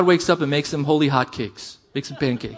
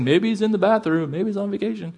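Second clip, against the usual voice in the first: higher-pitched mock taunt, trailing off softer; 3 semitones higher, 5 dB quieter than usual. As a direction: toss it out as a teasing jab. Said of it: "Elijah begins taunting them".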